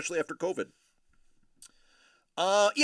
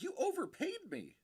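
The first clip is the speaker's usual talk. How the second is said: quoted Dangle; 5 dB quieter than usual. Retold or imitated. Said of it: "Dangle's like".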